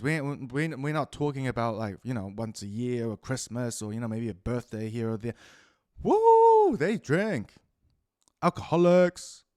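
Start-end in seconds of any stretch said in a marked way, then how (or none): 6.0-6.8 high loud mock party whoop. Mocking drinking couples with a high party whoop. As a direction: whoop like a drunk partier, far higher.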